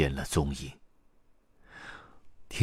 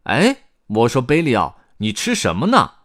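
louder, higher character voice; lively raised-voice query; calling to a friend; 8 semitones higher, 10 dB louder than usual. A narrator's reading. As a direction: call over with a loud, curious question. One character calls to another.

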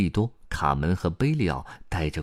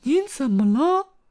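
very high, worried little voice; high, loud concerned question; uneasy; 6 dB louder than usual. A narrator's reading. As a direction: ask anxiously in a squeaky voice. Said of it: "卡门不安地问道".

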